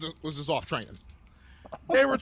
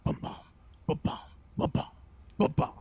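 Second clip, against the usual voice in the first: quiet breathy "ba-bum" drum pulse; imitated drum beat; 3 semitones lower, 3 dB quieter than usual. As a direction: tap out the drumbeat softly, half whispered. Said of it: "you start hearing the drum".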